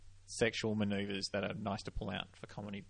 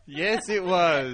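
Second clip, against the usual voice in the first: big high, loud burst of laughter; real laughing; 9 semitones higher, 14 dB louder, much slower than usual. Real, not acted. Comic amused by a slip of the tongue, laughing. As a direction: laugh hard, then stretch the words out slowly.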